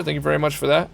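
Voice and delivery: usual speaking voice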